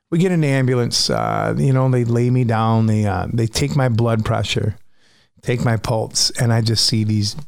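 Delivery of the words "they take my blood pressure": normal talking voice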